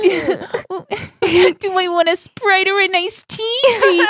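high, slow cooing voice; cutesy baby talk. Pretend. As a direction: coo it like a sugary couple. Mocking couple pet-name talk.

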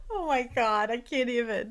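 ordinary talk, nothing marked

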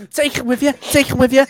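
high, loud mock-Scouse jeer; goading Scouse shout; Scouse accent. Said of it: "rendition of him getting out of the car".

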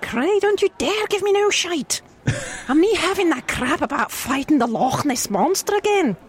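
a Scottish wife rejecting a tall tale; angry Scottish wife. Acted. A Scottish wife refusing her husband's excuses, high and exasperated. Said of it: "can I do a Scottish?".

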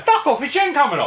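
high-pitched, thrilled disbelief; real excitement. Real, not acted. Podcaster learns a favourite guest is coming, genuinely excited. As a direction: burst out in delighted disbelief. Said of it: "I'm so excited".

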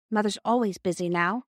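usual speaking voice

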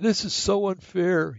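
put-on high, slow, drawn-out childish whine; sulky grandkid. Grandson protests grandpa's rules, high slow whine.

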